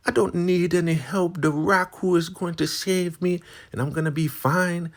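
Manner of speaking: high, loud defiant voice; proud Marva. Retold or imitated. Marva refusing help from the women, loud and defiant. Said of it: "She's like".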